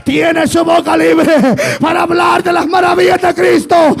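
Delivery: high, shouted revival sermon; fervent Pentecostal shout; full-force preaching. Real, not acted. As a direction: preach it at the top of your register, long cries on line endings.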